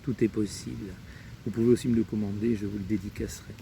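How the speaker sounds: ordinary talk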